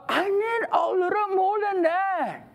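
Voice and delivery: put-on tearful pleading; whiny complaint. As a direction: whine it out like an unsatisfied complainer. Acting whining complainer to God.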